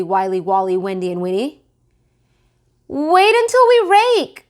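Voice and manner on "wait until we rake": put-on very high, loud child's complaint; protesting kids. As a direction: complain like bossy little kids. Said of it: "said Bunny".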